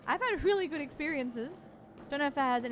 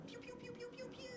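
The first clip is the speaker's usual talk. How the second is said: quiet mouth gun noises; 11 semitones higher, 16 dB quieter, much faster than usual. Retold or imitated. Imitating gunfire.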